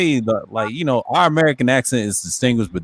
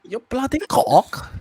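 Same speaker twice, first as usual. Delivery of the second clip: mock British accent; 11 semitones higher, much slower than usual. Mocking British insults.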